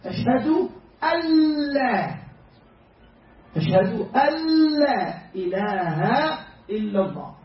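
slow Ashhadu alla with the lam doubled; correct merged reading. Shown as a demonstration. Teacher to class, modelling the merged lam slowly. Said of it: "We combine it with the lam".

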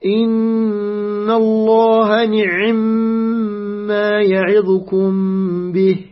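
measured Quran recitation, ayn closed in نعم before a long humming m; ayn with sukun. Shown as a demonstration. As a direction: chant the verse slowly, نعم as ni'-mma with a vowelless ayn. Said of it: "عين ساكنة. سأقرأ الآن".

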